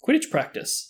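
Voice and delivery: high, eager team captain; keen captain voice. Acted. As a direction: wake him up with drive. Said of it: "said Wood".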